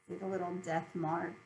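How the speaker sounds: usual speaking voice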